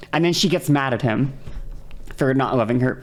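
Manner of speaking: usual speaking voice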